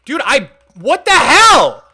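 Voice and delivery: loud, high frustrated yell; angry shout. Real, not acted. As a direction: blow up at the screen. Build deleted, yelling in frustration.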